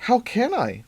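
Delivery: high indignant retort; demanding. Acted. Mother demands of son on phone, high indignant. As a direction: snap back, exasperated. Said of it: "Lynn demanded".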